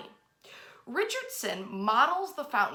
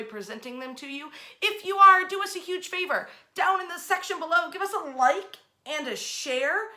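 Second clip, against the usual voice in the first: bright, eager call to action, higher and louder; upbeat sign-off; 4 semitones higher, 3 dB louder than usual. Acted. Host urging listeners to like, share and tag friends, eager promo voice. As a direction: pitch the likes and shares with energy. Upbeat outro asking for likes.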